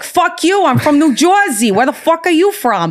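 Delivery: put-on high, brash New Jersey accent, cursing. To a Nashville girl asking if she's from New York, annoyed New Jersey accent. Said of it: "I did, like, a Jersey accent".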